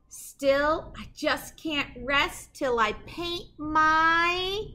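slow, drawn-out build to the rhyme; suspense pause. Acted. Reading to kids and pausing before the rhyme, drawn-out suspense. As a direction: leave them hanging before the rhyme.

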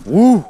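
loud high whoop of joy; excited whoop. Real, not acted. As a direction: let out a real whoop over the fish. Big fish hooked, whoops.

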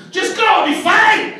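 very high-pitched argumentative yell; mock yelling. Man urging his girlfriend to go, frantic mock yelling. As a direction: yell it back, pitch shooting right up. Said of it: "yelling argument with her".

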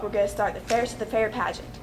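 usual speaking voice

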